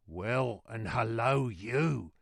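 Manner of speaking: hoarse put-on messenger voice; hoarse reply. A narrator's reading. Jerry answering the guard, hoarse reply. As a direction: answer back with a rasping throat. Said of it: "more hoarsely than before".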